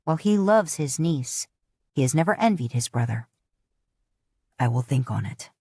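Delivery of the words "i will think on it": lightly voiced old chief, grave; deep-set elder warning; low chief voice. A narrator's reading. Chief warning his niece, low and grave.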